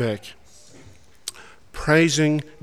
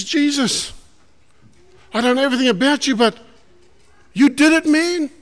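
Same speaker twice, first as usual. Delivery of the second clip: overjoyed thanks; 10 semitones higher than usual. Retold or imitated. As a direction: gush your thanks, unable to hold it in. Healed leper thanking Jesus.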